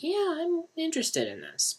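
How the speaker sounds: imitated viewer.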